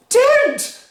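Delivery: very high, loud no; panicked cry. Acted. Missus Cranky stopping Grandma, panicked high cry. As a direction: cry out in alarm. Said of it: "cried Missus Cranky".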